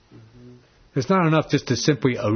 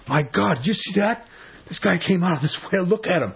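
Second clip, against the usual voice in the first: amazed onlookers; 6 semitones higher than usual. Retold or imitated. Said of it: "people thought".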